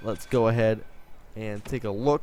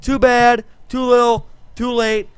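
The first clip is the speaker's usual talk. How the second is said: high, loud taunting sing-song; mocking harsh guard; 9 semitones higher, 9 dB louder than usual. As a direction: sneer it down at the prisoner. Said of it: "We'd be like".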